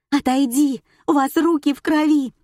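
high, loud cry; hysterical accusation. A narrator's reading. Nika pushes Spiridonova away and accuses her, hysterical. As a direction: cry out to fend them off.

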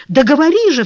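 impatient cry, high and loud. A narrator's reading. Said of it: "вскричал Леон". (нетерпеливый вскрик)

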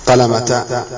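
قلمتا ending in open "-ta"; wrong t reading. Shown as a demonstration. Teacher offering a wrong option, قلمتا with final "-ta". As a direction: say قلمتا with a t in place of h, "qalamata". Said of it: "لا يمكن أن تنطق لا تاء مفتوحة".

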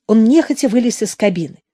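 ordinary delivery

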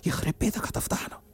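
tense hissed whisper, voice dropped right down; urgent whisper. Retold or imitated. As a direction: shush him sharply in a whisper. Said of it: "جالي بعصبية وقال لي".

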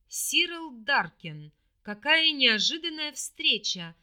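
slow, booming royal greeting; loud, powerful voice. A narrator's reading. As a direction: boom it out with full strength. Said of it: "громкий, полный сил голос".

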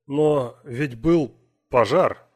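disbelieving cry, drawn out; shocked. Acted. Weir shocked to see Rhyme.

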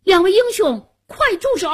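high, urgent call to halt; loud shout. A narrator's reading. Hero on the throne stops two fighters, loud high shout. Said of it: "高声喊道".

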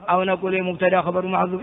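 usual speaking voice

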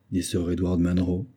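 usual speaking voice

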